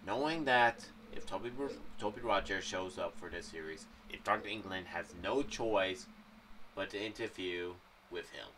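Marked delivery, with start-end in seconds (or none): none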